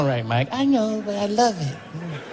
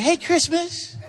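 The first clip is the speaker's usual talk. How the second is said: Michael voice; 9 semitones higher, slower than usual. Retold or imitated. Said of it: "He was like".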